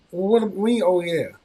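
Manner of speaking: high, loud disbelief; indignant father. Retold or imitated. Father demanding his daughter explain, loud and high. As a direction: throw her words back at her.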